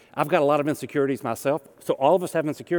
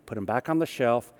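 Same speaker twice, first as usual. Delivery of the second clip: ordinary talk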